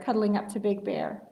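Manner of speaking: ordinary delivery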